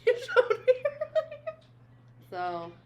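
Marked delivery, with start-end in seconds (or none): 0.1-1.6 laughing. Real, not acted. Laughing while telling friend.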